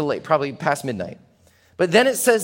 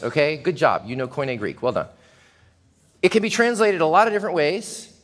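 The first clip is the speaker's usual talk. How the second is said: usual speaking voice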